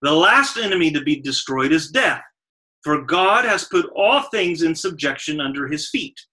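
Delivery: loud, emphatic Bible reading; scripture reading. Acted. Preacher reads Paul's verse to the congregation, emphatic scripture reading. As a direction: read the verse out with weighty stresses.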